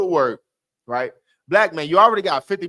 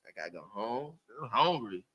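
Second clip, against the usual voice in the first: quiet whine; 6 semitones lower, 10 dB quieter than usual. Retold or imitated. Brother quoted, quiet and whiny.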